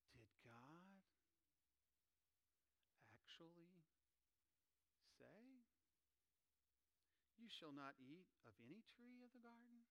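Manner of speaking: a tempting serpent coaxing quietly; serpent imitation. Preacher voicing the serpent to the congregation, sly and hushed. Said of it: "let me do my best serpent imitation".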